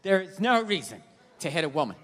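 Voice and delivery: low droning mock-sincere tone; mocking preachy voice. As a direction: parody a smug moralizer. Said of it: "They're like".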